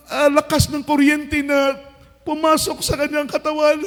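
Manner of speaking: ordinary talk, nothing marked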